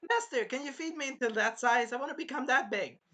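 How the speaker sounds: high-pitched begging little creature; eager Philo. Acted. Philo begging master to feed her bigger, high and eager. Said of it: "Philo was saying".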